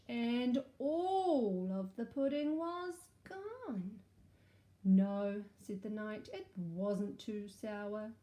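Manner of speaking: lively storybook read-aloud; animated picture-book narration; story reading to children. Acted. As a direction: read it like a storybook for little ones.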